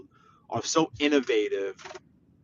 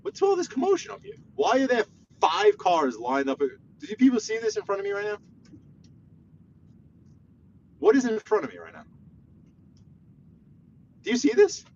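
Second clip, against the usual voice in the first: exasperated complaint; 9 semitones higher than usual. Real, not acted. Cars lined up ahead.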